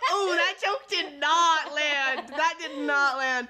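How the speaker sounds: slow, laughing admission. Real, not acted. Admitting her joke flopped to the roommate, laughing. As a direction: laugh it out, embarrassed.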